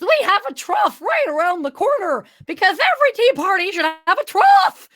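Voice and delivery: high, loud excitable host; Mad Hatter voice. Acted. Mad Hatter assuring a guest about the trough, high and excitable.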